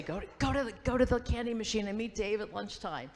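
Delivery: high, loud, insistent retold order; mock bossy urging. High school friend sending her to meet Dave, mock-urgent. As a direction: imitate a pushy friend sending you on an errand.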